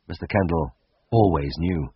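plain reading voice

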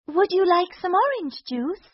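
high, sugary offer; cartoon princess. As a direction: sweetly tempt the villain. Princess offers drugged juice.

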